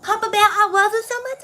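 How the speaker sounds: put-on very high, loud cooing baby talk. Shown as a demonstration. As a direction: coo it at him like a toddler. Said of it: "I can do my baby voice".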